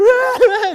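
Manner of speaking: high, loud mock crying wail; imitated toddler wail. Pastor imitates his forgotten toddler, high mock wail. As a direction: wail like an abandoned little kid. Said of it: "just crying".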